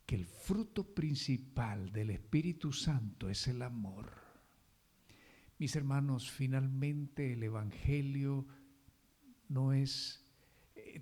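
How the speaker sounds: ordinary talk, nothing marked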